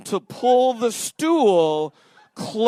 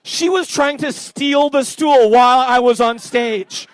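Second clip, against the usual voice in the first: loud, raised, comic outrage; indignant outburst; 5 semitones higher, 8 dB louder, slower than usual. Real, not acted. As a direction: scold the crowd in exasperated disbelief. Indignant at audience member taking stool.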